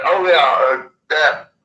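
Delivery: ordinary talk, nothing marked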